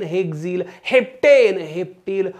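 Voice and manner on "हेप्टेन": हेप्टेन louder and higher, falling at the end; punchy classroom call; shouted drill. Shown as a demonstration. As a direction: call out हेप्टेन to lead a chant. Chants alkane against alkyl name.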